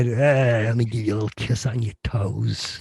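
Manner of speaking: low, lecherous character voice; Uncle Paul. Acted. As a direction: play a leering old creep. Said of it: "Uncle Paul's still around, believe me".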